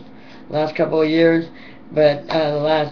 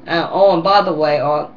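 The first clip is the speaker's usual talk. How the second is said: normal talking voice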